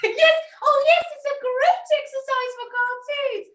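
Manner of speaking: very high, squeaky enthusiasm; high cartoon voice. Shown as a demonstration. Teacher plays along with Joe, high cartoon voice.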